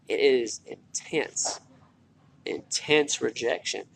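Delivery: intense drawn out slowly with pauses; लंबे ठहराव के साथ धीरे-धीरे "intense"; slow emphasis. Real, not acted. Slowed for emphasis.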